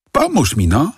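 high impatient plea. A narrator's reading. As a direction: urge him, high and pushy. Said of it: "dodał zniecierpliwiony". (zniecierpliwiona, wysoka prośba)

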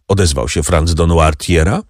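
plain reading voice

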